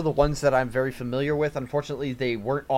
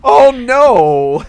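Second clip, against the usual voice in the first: mock alarm; 10 semitones higher, 12 dB louder than usual. Reacts to falling off bluffs.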